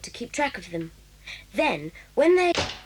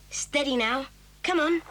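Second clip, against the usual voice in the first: normal talking voice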